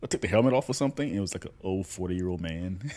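ordinary delivery

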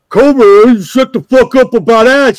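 loud mocking scold; mock angry yell. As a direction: bark it like an angry friend. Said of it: "you usually like".

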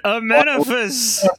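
slow, drawn-out mock incantation; incantation call. Quoting the spell from the movie, slow mock incantation. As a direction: intone the spell name like a summoning. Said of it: "and then he said".

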